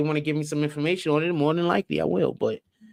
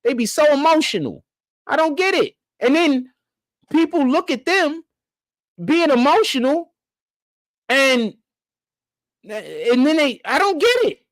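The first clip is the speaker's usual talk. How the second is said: high-pitched, halting incredulity; exasperated bafflement; 9 semitones higher than usual. Real, not acted. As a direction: sputter in disbelief with the pitch way up. Baffled rant, cannot understand them.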